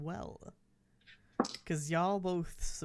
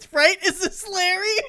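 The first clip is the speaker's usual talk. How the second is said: high, loud laughing echo; laughing loudly; 12 semitones higher, 11 dB louder than usual. Real, not acted. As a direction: shriek it through laughter.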